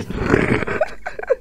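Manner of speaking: squeaky airless laugh; real helpless giggle; high breathy giggle. Real, not acted. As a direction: let a high giggle burst out. After retelling ostrich joke.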